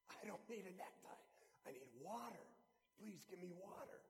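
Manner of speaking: soft, dry, pleading croak; parched plea. Retold or imitated. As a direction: beg faintly through a dry throat. Thirsty man refuses neckties.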